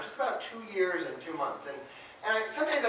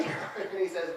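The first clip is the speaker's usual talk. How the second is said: ordinary talk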